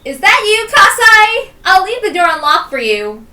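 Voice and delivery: loud, high call to the neighbour; shouting through the door. Acted. Calling out to the neighbour next door, loud shout. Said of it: "shouted to him".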